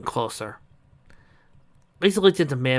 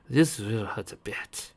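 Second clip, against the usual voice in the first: slow, soft menace; cold quiet warning; sinister villain voice; 4 dB quieter, slower than usual. Acted.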